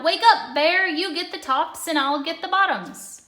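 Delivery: loud, high wake-up call. A narrator's reading. Hare calls sleeping Bear at harvest time, loud call. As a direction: shout across the field to Bear. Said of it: "Hare called out".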